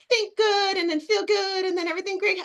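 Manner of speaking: high, airy mocking voice for the think-good crowd; mock naive positivity. Mocking the think-good-feel-good people in a high voice. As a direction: lampoon the simplistic feel-good idea. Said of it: "So this is not one of those".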